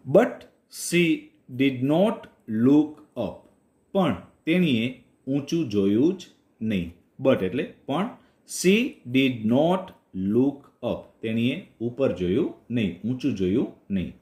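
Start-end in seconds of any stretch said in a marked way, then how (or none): 0.1-3.5 slow, careful English read-aloud; slow story reading. Shown as a demonstration. Teacher reading a story line to Gujarati learners, slow and clear. 8.6-11.2 very slow, each word apart; very slow repeat. Shown as a demonstration. Teacher repeating the story line very slowly for learners. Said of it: "repeated slowly for learners".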